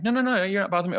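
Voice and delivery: very high, mock-insistent protest; insistent reassurance. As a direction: protest over-politely, pitch shooting right up. Acting out more insistent reassurance.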